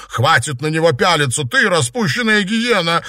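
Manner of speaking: high, loud scolding; indignant exclamation. A narrator's reading. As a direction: cry out in jealous outrage. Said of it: "воскликнула она".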